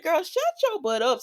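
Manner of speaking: put-on high-pitched "girl, please" scolding; exasperated scolding. Viewer talking back to a soap character, high exasperated scolding.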